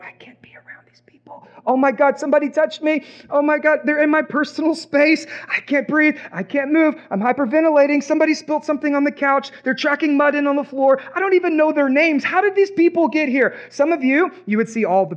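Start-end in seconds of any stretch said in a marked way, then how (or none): 0.0-1.5 breathless whisper of an overwhelmed introvert; panicked whisper. Retold or imitated. Introvert in a packed room, frantic whisper. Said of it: "all the introverts in the room, you're like". 1.7-13.5 higher, rushed mock panic. Introvert crushed by the crowd, higher mock panic. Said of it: "all the introverts in the room".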